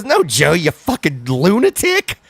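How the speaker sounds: high, exasperated comic outburst; Bill Burr. Retold or imitated. Comedian berating his podcast friend, high mock exasperation. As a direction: scold him in mock disbelief, drawing out the end. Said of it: "Bill Burr's like".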